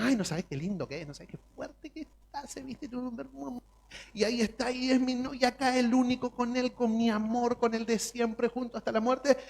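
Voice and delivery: high, hushed swooning voice; lovestruck gushing. Retold or imitated. Young bride bragging about her husband, high and swooning. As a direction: sigh over him like a dazzled bride. Gushing newly-wed in love.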